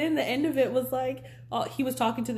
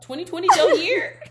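excited cheer; 10 semitones higher, 10 dB louder than usual. Real, not acted. Cheering the new year.

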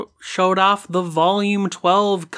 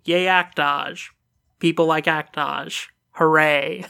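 deadpan cheer. Real, not acted. Host announcing the poll win to co-hosts, deadpan cheer. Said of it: "You sound so excited".

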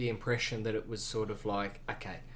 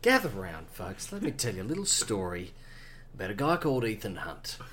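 lower-pitched folksy showman pitch; snake oil seller; 4 semitones lower, 4 dB quieter than usual. Pretend. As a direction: draw the crowd in like a travelling salesman. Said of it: "You're such a good storyteller".

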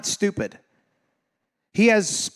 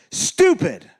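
loud, high exclamation; indignant; 5 semitones higher, 5 dB louder than usual. Real, not acted. Preacher on what somebody did, indignant. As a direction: punch the single word out in exasperation. Indignant verdict repeated in a sermon.

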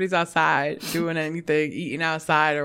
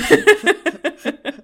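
laughing; 10 semitones higher, 5 dB louder than usual. Real, not acted. Laughing at story.

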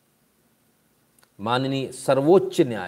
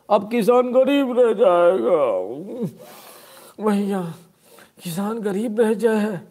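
high, slow, hammed-up wail; mock lament; 8 semitones higher, 6 dB louder, much slower than usual. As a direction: mockingly bewail the farmer's fate, drawn out. Mocks lament over poor farmers. (बनावटी विलाप, ऊँचे सुर में खींचकर)

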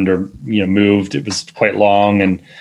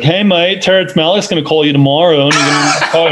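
a bad, hammed-up Australian accent, pitched higher; [name]'s Australian accent; 6 semitones higher than usual. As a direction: mimic the Australian friend with a deliberately awful Australian accent. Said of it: "it's awful Australian accent".

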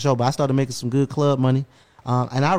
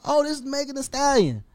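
surprised realization; 12 semitones higher than usual. Retold or imitated. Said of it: "I was like".

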